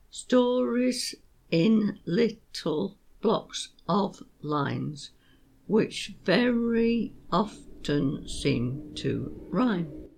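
very slow, every beat spelled out; slow rhythmic recital. Shown as a demonstration. Teacher rereading her couplet slowly to show its rhythm. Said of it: "which is a bit slow".

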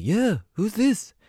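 very high, slow British pop star voice. Retold or imitated. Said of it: "He's like".

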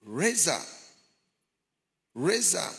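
retold voice hailing someone; calling out. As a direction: call the name out as if across a distance. Voices in hell calling him.

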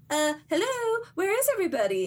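high, tentative mock call; high-voiced puzzled searching; lost character calling out. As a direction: call into an empty place, pitched high. Masuko calls out, lost.

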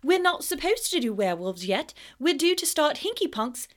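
high, pleading protest; eager Hermione. A narrator's reading. Hermione protesting to Snape, high eager voice. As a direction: burst out, pitched high. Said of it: "seemingly unable to restrain herself".

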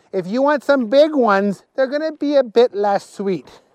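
lightly voiced keen host; eager Willie, reassuring. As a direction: explain a delicacy in a high, keen voice. Willie explains the big worms.

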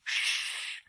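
sharp unvoiced hush; hissed shush. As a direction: hiss for silence. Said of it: "hissed Professor McGonagall".

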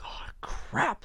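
high, soft, airy dread; retold hushed alarm at a distant threat. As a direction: let it out high and under the breath. Retelling reaction to a distant howl.

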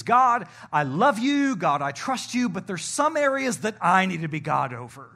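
loud mock plea to God; mock prayer. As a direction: voice the listener praying, half-sincere. Voicing the congregation's prayer.

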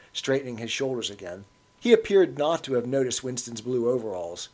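plain reading voice

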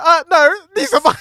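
high, loud comic objection; mock indignant protest. Storyteller claiming the police from station staff, mock indignant. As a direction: object in a squeaky rush, near laughter.

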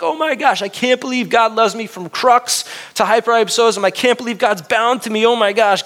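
high-pitched amazed gushing; awed thankfulness. Acted. Believer marveling at God's love, awed thankfulness. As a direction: pour it out in high, overwhelmed wonder. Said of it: "that awe, that, that thankfulness".

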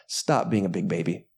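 normal talking voice

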